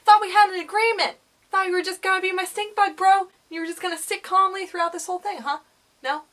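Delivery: high, loud, rattled protest; startled scolding. Real, not acted. Vlogger to stink bug that scared her, loud indignant scolding. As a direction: tell off a bug that just scared you.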